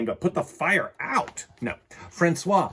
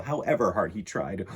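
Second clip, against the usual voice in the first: ordinary delivery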